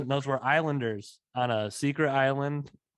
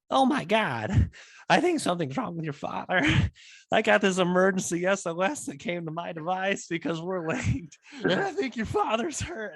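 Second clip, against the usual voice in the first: high, panicky mock-mother voice; frantic mom; 7 semitones higher than usual. Son imitates mom fearing dad is hurt, high and frantic. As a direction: imitate a worried mom in a high-pitched fluster. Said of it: "you know, fucking frantic".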